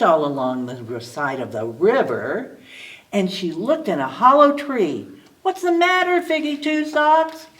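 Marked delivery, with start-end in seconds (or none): 5.4-7.5 high little squirrel voice calling out. A narrator's reading. Hoptail the squirrel calls out to Figgy, high voiced. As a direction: call out in a high small squirrel voice. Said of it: "she heard a voice cry".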